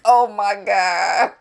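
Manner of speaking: slow, drawn-out stunned oh my God; stunned disbelief. Retold or imitated. Vlogger recalling her reaction to his anger, slow stunned disbelief. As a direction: let the shock sink in slowly. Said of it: "I was like".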